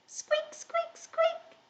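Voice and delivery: tiny, high mouse squeaking; mouse squeaks. Shown as a demonstration. Teacher shows children the mouse cue, tiny high squeaks.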